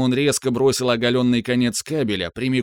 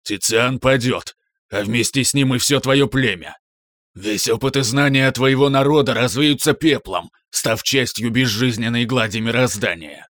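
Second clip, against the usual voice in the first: dark lord. Acted. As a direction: intone doom like a dark lord. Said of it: "зловещим басом прохрипел Марк".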